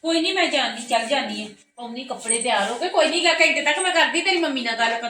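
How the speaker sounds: calling out. Acted. Aunt calls loudly to the niece about the trip. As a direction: call out cheerfully to the child.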